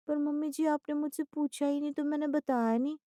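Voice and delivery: put-on high, polite young wife voice; innocent daughter-in-law.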